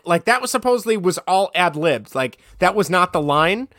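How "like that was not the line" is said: normal talking voice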